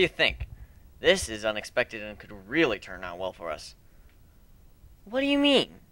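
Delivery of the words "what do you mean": put-on girl voice. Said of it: "Nadine asked".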